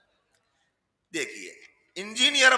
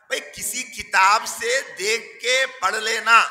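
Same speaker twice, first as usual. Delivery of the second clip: ordinary delivery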